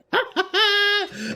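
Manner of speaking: shrieking high burst of laughter; helpless squeal of a laugh. Real, not acted. Streamer cracking up at his crafting fail, squealing laugh.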